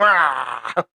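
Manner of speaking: sudden open-throated monster "raah"; imitated walker snarl. Describing a walker popping up right in Daryl's face, imitated snarl. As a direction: mimic a zombie lunging with a short snarl.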